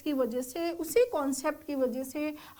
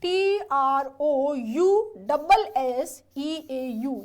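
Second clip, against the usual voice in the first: letter by letter, very slow and a bit louder; teacher dictating the spelling slowly; 6 dB louder, much slower than usual. Shown as a demonstration. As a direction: dictate each letter so students can write it. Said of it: "spelling क्या है ट्रूसो की?".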